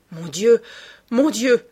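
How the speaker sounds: loud, aghast outcry; crying out. A narrator's reading. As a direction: cry out in shock, voice lifted on the repeat. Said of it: "cria-t-il".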